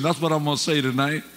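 usual speaking voice

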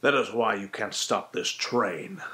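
gruff menacing movie villain; a hint of Liam Neeson threat; Liam Neeson style. Shown as a demonstration. Tutor showing a similar gruff movie voice. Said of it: "it's similar to the voice".

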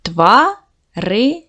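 "Тварини" said slowly, syllable by syllable: тва-ри-ни; split into syllables. Shown as a demonstration. Teacher dividing the word into syllables for second graders, slow and stretched. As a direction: stretch "Тварини" out, beat by beat, with a rise at the end. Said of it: "Ділимо на склади".

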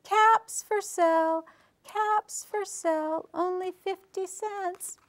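high, slow singsong vendor call; peddler's street cry. A narrator's reading. Peddler walks back to town selling caps, mock yelling.

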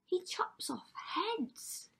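high, slow, horrified Cindy voice; dismayed thought. A narrator's reading. Cinderella realising what her prince does, dismayed thought. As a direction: draw it out high, in disbelief. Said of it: "she thought".

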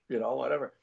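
ordinary talk, nothing marked

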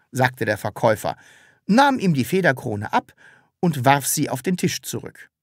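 usual speaking voice

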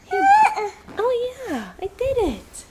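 very high, proud pet voice; squeaky dog voice. Pretend.

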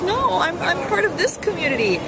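half-hearted indignant objection; mock protest. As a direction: lightly act out pleading to belong. Protesting being labeled the American.

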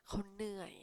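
whispered เขาเหนื่อย; soft whispered gloss. Shown as a demonstration. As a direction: breathe the words out as a whisper. Whispers the meaning.

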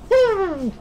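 high-pitched cheering whoop; excited whoop. Real, not acted. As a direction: let out a high "woo". Cheering during game fight.

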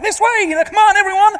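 high-pitched tour guide calling out; American tour lady. Retold or imitated. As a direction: imitate a lady rallying her group. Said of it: "led by a sort of a lady with an umbrella".

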